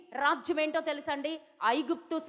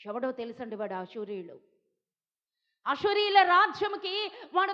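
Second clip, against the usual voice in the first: ordinary delivery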